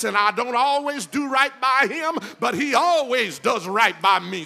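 preaching at full force. Real, not acted. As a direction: whip the church up line by line. Call-and-response climax, building.

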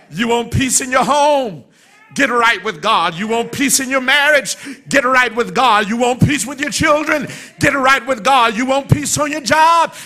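rising, shouted preacher's call; preaching at full force. Acted. Call-and-response preaching builds.

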